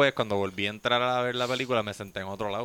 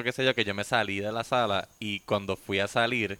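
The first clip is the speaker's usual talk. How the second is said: ordinary talk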